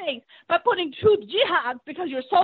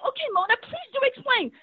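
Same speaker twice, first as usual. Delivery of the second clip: sarcastic mimicry; 6 semitones higher than usual. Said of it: "like, sarcastic with me".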